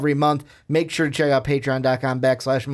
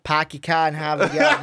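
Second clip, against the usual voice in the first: mock Boston accent. Joking accent, parody of "park the car.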